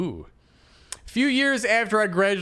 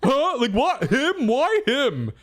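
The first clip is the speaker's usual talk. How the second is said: higher, laughing put-on old guy begging Zia; mock old man pleading; 5 semitones higher than usual. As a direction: ham up the old driver asking why she stays.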